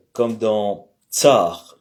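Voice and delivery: « tsar » articulé lentement, ts bien détaché; démonstration lente du ts de « tsar »; prononciation lente. Shown as a demonstration. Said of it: "vous avez le ts". (le professeur détache lentement « tsar » pour les élèves)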